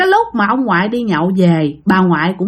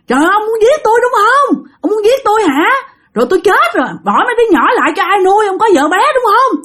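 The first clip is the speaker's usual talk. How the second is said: high-pitched, aggrieved wife ranting; outraged grandmother, pitched way up; furious accusing; 10 semitones higher, faster than usual. Acted. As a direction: fire accusations at the husband in a high voice. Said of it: "bà ngoại tức lắm".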